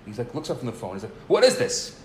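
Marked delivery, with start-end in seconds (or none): 1.3-2.0 loud, high retold demand; irritated yell. Stranger breaks off his phone call to ask, imitated irritated yell. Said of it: "he's still screaming on the phone".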